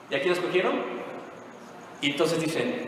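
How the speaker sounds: usual speaking voice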